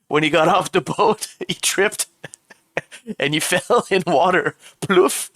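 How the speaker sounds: giggling through the telling; laughing while talking. Real, not acted. Streamer tells chat a surname legend, laughing. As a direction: tell it while cracking up.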